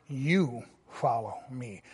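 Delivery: You weighted, the rest soft and falling; stress on YOU. Shown as a demonstration. Pastor showing Jesus's emphatic You to Peter. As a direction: lean on You, then drop away.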